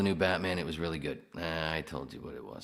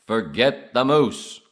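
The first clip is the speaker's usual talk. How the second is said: very loud, slow, higher character voice; acted movie line; 7 semitones higher, 18 dB louder, slower than usual. Repeating Arthur's moose line, loud drawn-out acted voice. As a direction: boom it out slowly in character.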